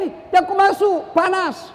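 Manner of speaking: high-pitched mock cry of warning; retold shouted warning. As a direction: imitate calling out an urgent warning. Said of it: "dia teriak".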